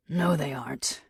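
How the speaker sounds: unfazed, matter-of-fact captor. Acted. Captor correcting his victim, matter-of-fact. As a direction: contradict her without any fuss. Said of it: "this matter of fact".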